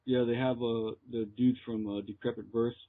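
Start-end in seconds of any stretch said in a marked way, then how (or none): none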